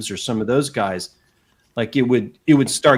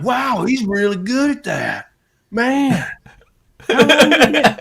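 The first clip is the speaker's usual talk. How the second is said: imitating impressed Americans, much higher; awestruck mimicry; 12 semitones higher, 4 dB louder than usual. American fans marvel at his fake accent, awestruck mimicry. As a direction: gush in mock amazement, pitched way up.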